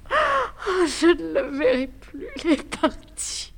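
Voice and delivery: high, breaking sobs of grief; sobbing. Acted. Woman alone after lover leaves, sobbing. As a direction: cry out her loss once he's gone. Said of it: "tu pleures".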